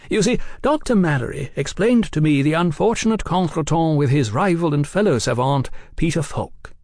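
lightly voiced, genial gentleman; cordial gentleman journalist. A narrator's reading. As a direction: explain yourself with airy cordiality. Oliphant explains at length.

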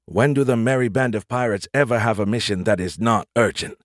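ordinary delivery, nothing marked

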